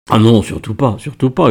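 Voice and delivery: plain reading voice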